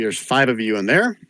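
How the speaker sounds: ordinary talk, nothing marked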